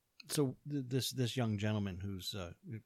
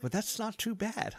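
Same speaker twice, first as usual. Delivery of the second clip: high, louder quoted voice; imitated Michael; 13 semitones higher, 5 dB louder than usual. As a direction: mimic his pleasantly surprised verdict, pitched way up. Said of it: "He says".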